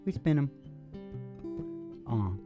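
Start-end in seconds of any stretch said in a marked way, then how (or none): none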